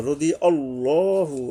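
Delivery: রদি আল্লাহু said slowly with আল্লাহু stretched; slow drawn-out recitation. Shown as a demonstration. Teacher recites রদি আল্লাহু, slow chant. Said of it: "এই আল্লাহ নয়".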